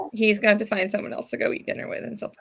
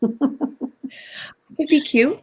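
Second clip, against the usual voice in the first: laughing through the words; 5 semitones higher, much slower than usual. Real, not acted. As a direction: giggle it out slowly. Laughing while speaking.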